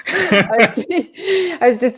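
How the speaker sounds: laughing through the first words; giggling, caught off guard. Real, not acted. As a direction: break into a laugh as you start. Laughs while starting reply.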